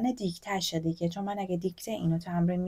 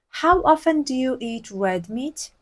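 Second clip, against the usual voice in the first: very slow, careful English for learners; slow model reading; 3 semitones higher, 4 dB louder, much slower than usual. Shown as a demonstration. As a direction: read it out slowly for the class.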